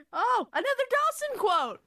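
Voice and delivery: loud, high, slow excited cry. Real, not acted. Finding another friend's quote, announcing it with loud glee. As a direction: announce it with delight.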